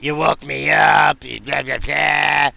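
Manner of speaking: loud, slow mock complaint; mock wife. Husband mimics wife nagging him, loud and slow. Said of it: "instead of laughing at it".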